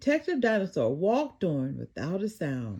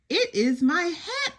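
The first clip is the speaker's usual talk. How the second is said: cried; 9 semitones higher, 3 dB louder, slower than usual. A narrator's reading. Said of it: "cried Detective Dinosaur".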